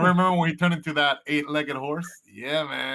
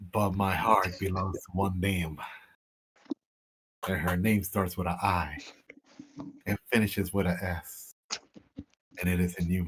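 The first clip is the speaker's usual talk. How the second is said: tender, sincere; 8 semitones lower, 6 dB quieter than usual. Acted. Ren turning down an admirer for another love, tenderly sincere. Player voices Ren's tender refusal.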